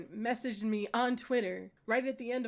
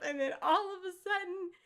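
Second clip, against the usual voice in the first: ordinary talk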